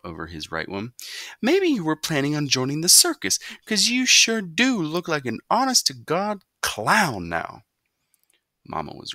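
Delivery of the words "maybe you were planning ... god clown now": mocking. A narrator's reading. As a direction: make fun of his clown hair. Mother mocks son.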